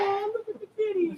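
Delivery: high, cooing kitten-admiring voice; high-pitched gentle squeal of delight; high head voice. Shown as a demonstration. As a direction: coo it lightly, up in the head. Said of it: "higher stuff is a little more in your head".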